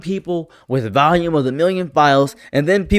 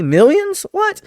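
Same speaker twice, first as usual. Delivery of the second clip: "Millions?" and "What?" jumping much higher; mock disbelief; slower than usual. Reacting to millions of pages, mock astonished squeak. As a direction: squeak out the shock.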